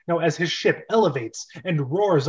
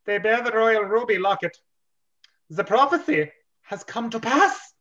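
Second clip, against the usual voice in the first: higher, grand prophetic tone; solemn captain voice; 6 semitones higher than usual. Acted. Captain proclaiming the prophecy to the Gilas. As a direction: announce the prophecy in awe. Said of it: "I need a voice for this one".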